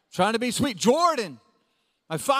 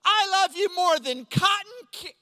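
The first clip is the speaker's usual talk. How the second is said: put-on little boy; 7 semitones higher than usual. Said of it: "And then he said".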